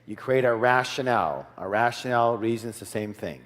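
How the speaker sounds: ordinary delivery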